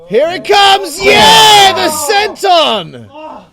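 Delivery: high, loud screaming call; excited shout. Real, not acted. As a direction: yell it as it lands. Climactic move call.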